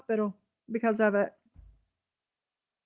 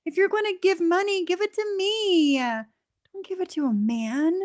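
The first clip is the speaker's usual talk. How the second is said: mocking; 7 semitones higher than usual. Mocking Maria's words.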